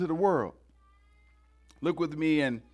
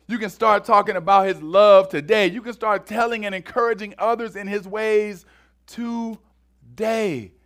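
louder and higher, driving preacher cadence; full-force preaching; 5 semitones higher, 6 dB louder than usual. Acted. Preacher urging the congregation to act today, rousing sermon voice. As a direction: build the sermon to a rousing call.

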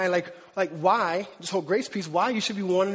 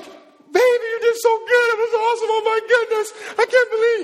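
much higher and louder, overcome with awe; gushing new father; 12 semitones higher, 6 dB louder than usual. Retold or imitated. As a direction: rave at your wife right after the birth. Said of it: "You're crying, acting all crazy".

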